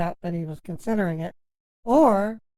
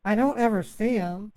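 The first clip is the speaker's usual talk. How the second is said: ordinary talk, nothing marked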